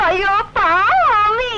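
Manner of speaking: slow, drawn-out daughterly plea; long, lilting sympathetic whine; pleading pity. Acted. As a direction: stretch it out, begging mummy to take pity. Daughter pleads with mother for cousin.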